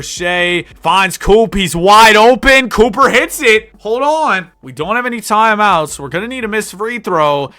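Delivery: excited play-by-play. Acted. Streamer calls the late three in the finals, loud excited call. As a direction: shout the open three like a big moment.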